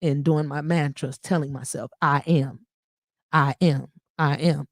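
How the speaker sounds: hushed meditative mantra; meditation voice. Shown as a demonstration. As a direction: breathe it out calmly. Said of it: "the real meditation".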